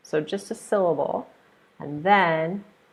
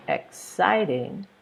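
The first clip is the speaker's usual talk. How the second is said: EXCITING slowly, syllable by syllable; exciting, slow and carefully divided; split into syllables; 3 semitones higher, slower than usual. Shown as a demonstration. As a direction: draw exciting out into its three syllables. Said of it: "there's three syllables".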